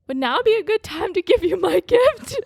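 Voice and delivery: high-pitched imitation of mom, laughter in it; high, giggly retold offer; mock sweet mom. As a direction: imitate an oblivious mom, amused. Mom's ill-timed offer acted out.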